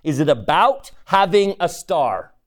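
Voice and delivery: leaning on having; rhetorical, pointed; emphatic question. Shown as a demonstration. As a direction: press the word having. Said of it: "repeats question".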